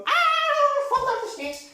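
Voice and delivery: squeaky, mocking girl's shriek into a curse; mock high scream. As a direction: parody the screaming kid, high up. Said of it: "That's all I heard, like, going".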